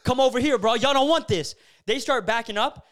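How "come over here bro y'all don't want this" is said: loud, high tough-guy challenge; calling out a crowd of attackers, daring them to come; yelling threats. Retold or imitated. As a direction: shout them down like you're ready to fight. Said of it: "I start going".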